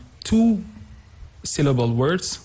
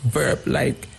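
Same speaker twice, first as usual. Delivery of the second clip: ordinary delivery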